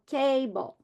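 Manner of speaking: CABLE slowly, stressed on the first syllable; slow KAAY-bul, weight up front. Shown as a demonstration. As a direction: stretch out the KAY in cable. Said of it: "the stress will be on the first syllable".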